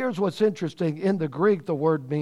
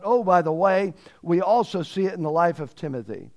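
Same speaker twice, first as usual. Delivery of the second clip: ordinary talk, nothing marked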